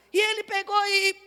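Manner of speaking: normal talking voice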